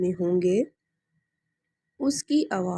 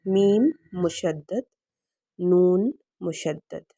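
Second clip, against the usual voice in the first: میم مشدد and نون مشدد named slowly; name the letters deliberately; slow, clear letter names; slow naming; slower than usual. Shown as a demonstration. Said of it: "دو حروف ہیں".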